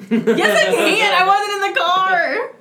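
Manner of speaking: loud, high, laughing outburst; indignant protest. Real, not acted. Defending oneself to teasing friends, loud and indignant. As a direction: burst out in real protest, half laughing.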